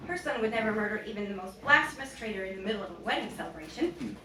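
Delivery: usual speaking voice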